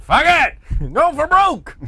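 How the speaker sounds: high, loud, laughing yell; reckless shout. Retold or imitated. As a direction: go for broke, all in. Acting out a tired fighter.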